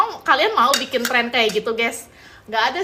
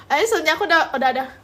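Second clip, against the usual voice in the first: usual speaking voice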